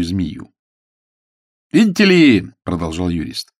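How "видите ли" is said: high, loud, eager lawyer; eager high-pitched opener from a lawyer; chatty lawyer. Acted. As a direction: call it out as a voluble lawyer. Juрист speaks in the story.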